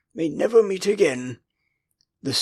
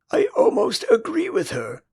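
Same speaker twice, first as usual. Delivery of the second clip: much higher, sharp retort; snapped; 12 semitones higher than usual. A narrator's reading. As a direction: snap it back. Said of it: "Wendy snapped".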